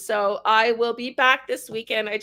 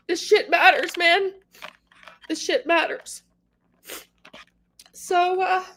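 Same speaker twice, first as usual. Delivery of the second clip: crying; 7 semitones higher than usual. Real, not acted. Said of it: "sorry for crying".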